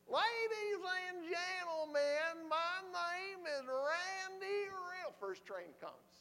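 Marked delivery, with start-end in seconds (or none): 0.1-5.1 high, very slow, loud Southern drawl; Randy Riddle. Retold or imitated. Friend addressing a subway crowd, slow Southern drawl. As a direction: imitate a Southern street preacher stretching every word. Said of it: "You gotta speak a little faster".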